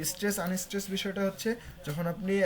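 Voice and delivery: ordinary delivery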